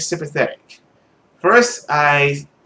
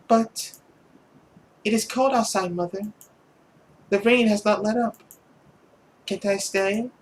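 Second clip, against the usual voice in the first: put-on higher, small girlish plea; pleading daughter; 6 semitones higher, 6 dB quieter than usual. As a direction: beg mother gently to stay. Voices the girl pleading.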